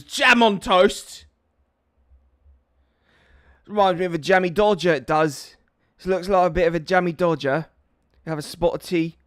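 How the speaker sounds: loud, hammed-up British accent; mock British accent. Streamer hamming up a British accent for chat, loud and mocking. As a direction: play a posh Brit over tea. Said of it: "dodgy Cockney accent is in it".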